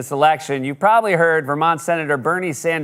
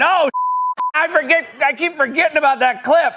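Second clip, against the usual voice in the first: laughing; 12 semitones higher than usual. Real, not acted. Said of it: "sudden recollection, laughing".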